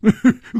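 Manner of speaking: quick loud laugh; embarrassed hero laughs; short burst of laughter. Acted. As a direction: let out a sudden loud laugh. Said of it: "胡铁花笑着说".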